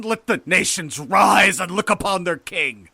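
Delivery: slow, high, thunderous decree; loud, drawn-out royal shout; exultant proclamation. A narrator's reading. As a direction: proclaim yourself to the whole world. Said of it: "I entered crying".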